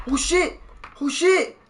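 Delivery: high-pitched startled yelp of words; real alarm. Real, not acted. Streamer reacting to a sudden spill, alarmed. Spills something mid-sentence.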